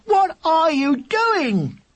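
high, drawn-out outraged cry; slow, exasperated wail of disbelief. A narrator's reading. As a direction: demand it at a high pitch. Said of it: "cried William".

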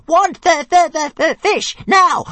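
shrieking, stuttering little dragon tantrum; very high, loud, frantic creature voice; wild dragon shouting. A narrator's reading. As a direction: screech demands at full volume, stammering. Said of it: "The dragon struggled wildly, shouting".

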